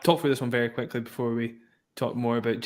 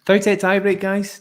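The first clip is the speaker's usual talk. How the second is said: excited announcement; 7 semitones higher, 7 dB louder than usual. Real, not acted. Announcing the tiebreak.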